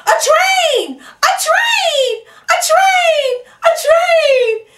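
high, loud squeals of excitement; escalating excited cries; excited shout. Acted. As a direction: shriek it out, thrilled by the train. Excited exclamation at the train.

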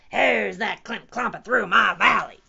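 loud, booming monster challenge; T-Rex roar. A narrator's reading. T-Rex challenges whoever crosses his valley, roaring. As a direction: roar it like an angry giant dinosaur. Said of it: "roared the T-Rex".

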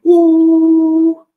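loud, very high sustained monitor whine; imitated machine tone. Heart monitor leads came off, imitated high alarm tone. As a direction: hold a loud high flatline "ooo". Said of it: "So the machine went".

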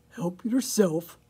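loud pig voice with a grunt; storybook pig, grunted loudly. A narrator's reading. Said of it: "grunted Pig".

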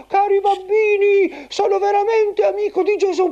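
put-on high squeaky old grandpa puppet; squeaky shrill puppet voice.

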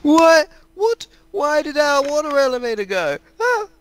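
high-pitched shocked cry. Real, not acted. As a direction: squeal it in disbelief. Shocked the elevator vanished.

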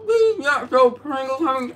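high sassy voice with mouth full. Real, not acted. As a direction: talk through a mouthful of chips. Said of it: "You don't talk with your mouth full".

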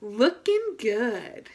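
slow, drawn-out "Looking good" in unison; slow shared self-praise; slow cheer together. Shown as a demonstration. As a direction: stretch out "Looking good" with the kids. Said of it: "Let's do it together".

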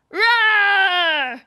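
loud, full-throated mock roar; monster roar. Shown as a demonstration. Host leading kids in a roar, loud monster roar. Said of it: "make sure it's really loud".

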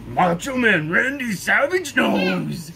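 loud, slow wrestler impression with some Cookie Monster; Macho Man Randy Savage. Showing off a voice to partner, loud wrestler impression. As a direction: growl it out like a hyped wrestler. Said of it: "a little bit of, uh, Cookie Monster".

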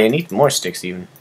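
ordinary talk, nothing marked

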